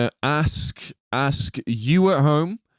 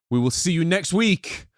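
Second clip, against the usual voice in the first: higher, drawn-out spooky show sign-off; 5 semitones higher, slower than usual. Pretend. Podcast host announcing next week's film, spooky voice. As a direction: stretch it out in a playful ghostly voice. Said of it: "always do the spooky voice".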